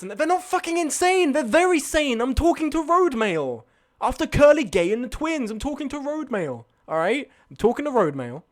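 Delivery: high, emphatic protest; exasperated insistence. Real, not acted. Insists to chat on the story.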